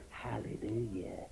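soft, slow, barely-there hallelujah; mild hallelujah. Retold or imitated. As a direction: say it softly, just saying it. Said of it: "They weren't just saying".

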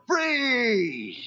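stretched, high-pitched retold yell; retold holler. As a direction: imitate a long falling call to runaways. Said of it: "I guess I hollered".

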